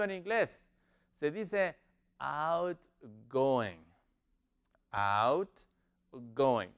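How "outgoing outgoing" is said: word split into two slow parts; lower, drawn-out teaching pronunciation; slow demonstration. Shown as a demonstration. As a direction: model it slowly, out... going, with a pause between. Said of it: "Más rápido se dice".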